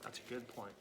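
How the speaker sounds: usual speaking voice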